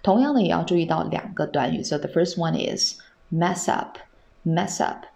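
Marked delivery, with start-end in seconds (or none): none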